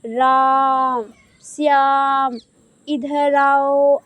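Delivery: राम, श्याम, इधर आओ each with the vowel stretched very slowly; drawn-out calling. Shown as a demonstration. Teacher calling pretend children to show pluta, stretched slowly. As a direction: call the names out long, as to someone far away. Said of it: "ऐसे मैंने खींच कर बोला".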